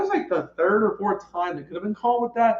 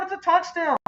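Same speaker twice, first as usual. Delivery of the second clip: excited call; 10 semitones higher than usual. Real, not acted. Touchdown call.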